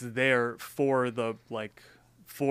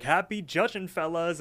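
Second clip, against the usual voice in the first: higher, singled-out mock send-off; gloating boss; 6 semitones higher, 4 dB louder than usual. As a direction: taunt them lightly as the departing boss. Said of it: "and continues to laugh".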